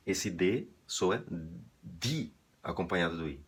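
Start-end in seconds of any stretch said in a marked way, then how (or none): none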